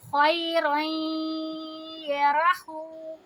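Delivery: slow chant, رائیں nasalised two counts; Quran recitation with ghunna. Shown as a demonstration. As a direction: hum the nasal in رائیں before یا. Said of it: "ہو کو دو حرکہ کریں گے". (رائیں میں غنہ کے ساتھ آہستہ تلاوت)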